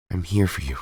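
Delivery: quiet and low, reassuring; soft reassurance. Acted. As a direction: promise her softly. Tommy comforts Kim.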